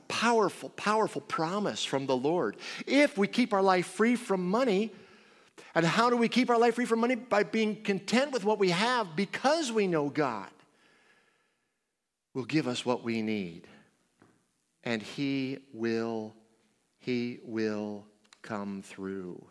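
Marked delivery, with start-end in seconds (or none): 2.9-10.5 preaching at full force. Acted. Preacher pressing the point. 14.8-19.4 slow reassurance. Acted. Preacher reassuring the congregation God comes through. Slow assurance after loud build.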